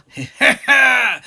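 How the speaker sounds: high gleeful laugh; delighted laugh. Acted. Popcorn grabbed by Drew, high gleeful laugh. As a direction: giggle with glee as you're grabbed.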